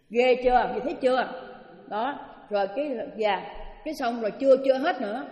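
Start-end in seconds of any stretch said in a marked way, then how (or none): none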